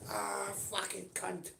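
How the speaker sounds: high, very slow drawn-out mock curse; mock telly rage. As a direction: stretch out a hammed-up groan of rage at the TV. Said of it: "You shouting at a telly".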